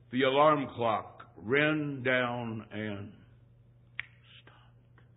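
slow down like a clock running out; winding down. Acted. Acts out alarm clock running down.

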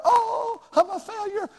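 high, lightly mocked wounded sigh; mock hurt. Preacher imitating a sensitive staff member, mock wounded. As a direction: play the crushed staff member. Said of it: "they'll cut their heart".

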